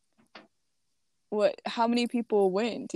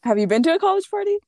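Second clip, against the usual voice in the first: surprised; 9 semitones higher, 8 dB louder, faster than usual. Real, not acted. Disbelief at friend's party claim.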